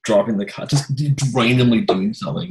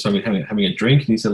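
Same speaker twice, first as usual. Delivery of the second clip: ordinary delivery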